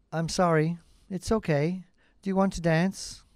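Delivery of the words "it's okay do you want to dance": usual speaking voice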